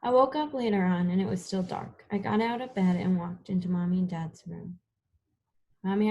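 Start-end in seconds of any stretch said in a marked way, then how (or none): none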